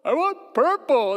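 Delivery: put-on high, loud kid wail, dragged out; dad acting out his son's tantrum; whining toddler. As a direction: mimic a tearful child demanding purple. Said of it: "This is".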